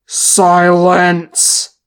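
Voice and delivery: huge, drawn-out commanding bellow. A narrator's reading. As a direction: roar it over a panicking crowd. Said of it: "he bellowed".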